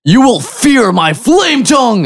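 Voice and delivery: high, menacing in-character shout; high-pitched heroic war cry; battle shout. Acted. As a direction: shout it at the monster as a threat. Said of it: "and shout at it".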